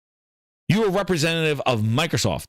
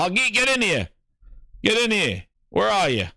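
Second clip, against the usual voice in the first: higher, raised voice calling someone in; mock call-out; 8 semitones higher than usual. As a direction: holler for him to get inside. Mock boss calling employee in.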